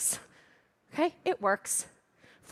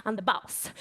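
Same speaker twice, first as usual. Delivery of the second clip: normal talking voice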